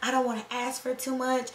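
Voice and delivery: mock a shy nurse afraid to ask; lightly voiced timid newbie. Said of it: "I was so timid".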